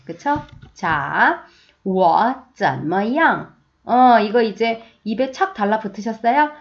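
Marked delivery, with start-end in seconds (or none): 1.8-3.5 very slow, syllable by syllable; slow teacher demo; slow model reading. Shown as a demonstration. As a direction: spell out each Chinese syllable slowly for learners. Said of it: "저랑 같이 두 번 읽어볼게요".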